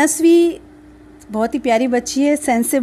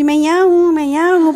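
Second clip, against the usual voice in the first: mock little-girl voice, pitched higher; retelling a daughter's cheeky call from the bucket, childlike and higher; toddler calling out; 5 semitones higher, 4 dB louder than usual. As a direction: imitate a playful toddler peeking out of the water. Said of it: "सब बस ये बोलती थी".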